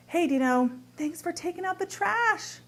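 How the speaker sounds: bright, genuinely thankful, rising high on trash; grateful. Shown as a demonstration. Wife thanking husband Dino for the trash, sincerely grateful. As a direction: thank him like you truly mean it. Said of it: "One can be really gratitude".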